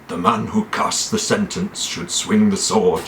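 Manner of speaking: gruff lordly pronouncement; grave, gruff character voice; Ned Stark. Acted. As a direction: put on a gruff, solemn northern lord. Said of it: "He goes like this".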